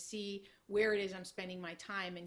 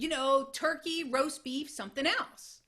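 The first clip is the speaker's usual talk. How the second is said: exasperated explaining; 6 semitones higher, 8 dB louder than usual. Retold or imitated. Buddy spells out other sandwiches.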